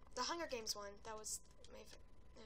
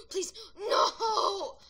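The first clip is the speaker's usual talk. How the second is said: gasping plea; 6 semitones higher, 10 dB louder than usual. Real, not acted. About to die.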